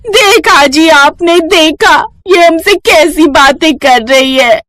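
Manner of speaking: high, slow, whiny fake sob to her husband; fake crying daughter-in-law. Daughter-in-law fakes tears to her husband, high slow whine. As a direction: put on wounded tears, drawn out. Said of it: "जोर-जोर से रोने का बहाना करती है".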